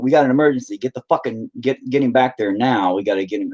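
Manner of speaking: usual speaking voice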